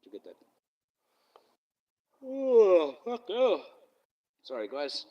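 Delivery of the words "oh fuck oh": high, loud weary groan; high-pitched groan of tiredness; tired groan. Real, not acted. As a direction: let out a real tired moan while swearing. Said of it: "Getting tired".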